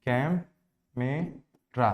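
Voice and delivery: कै, म, रा with long gaps; very slow camera in three separate beats; syllable by syllable. Shown as a demonstration. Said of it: "इसका क्या syllable होगा".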